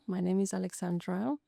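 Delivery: ordinary talk, nothing marked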